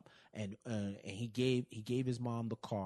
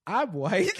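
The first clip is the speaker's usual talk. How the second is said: loud, higher, laughing through it; laughing quote; 9 semitones higher, 12 dB louder than usual. Retold or imitated. Retelling Sax's line, loud and laughing. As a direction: blurt it while cracking up. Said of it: "And Sax is like".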